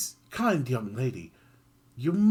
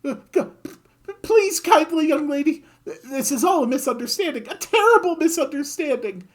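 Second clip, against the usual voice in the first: much higher, loud frantic babbling; panicked pleading; 8 dB louder than usual. Acted. Griffin begging Kid for mercy, high frantic panic. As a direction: grovel for your life.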